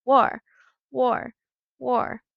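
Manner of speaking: high, loud War cries, fading a little; frenzied cry. Acted. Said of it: "the frenzied cry of".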